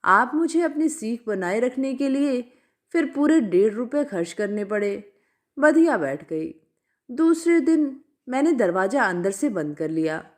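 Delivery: ordinary delivery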